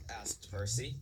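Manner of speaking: ordinary delivery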